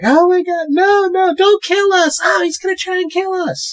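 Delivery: put-on very high, panicked girl squeal; squeaky parody of sheer panic; mock freak-out. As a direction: imitate terrified girls in a hammed-up shriek. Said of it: "These girls are freaking out".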